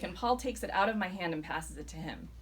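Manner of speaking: plain reading voice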